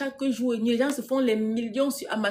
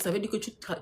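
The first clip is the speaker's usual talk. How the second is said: ordinary delivery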